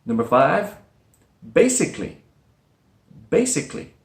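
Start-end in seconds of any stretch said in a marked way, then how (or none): 1.5-3.9 "basically" in three syllables, bay-sic-ly; dropped schwa. Shown as a demonstration. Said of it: "the schwa sound is dropped".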